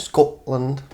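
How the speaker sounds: put-on Scotland in a light Scottish accent; a quick playful Scottish accent. Cohost answers her cohost with a light Scottish accent. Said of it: "I can't do a Scottish accent".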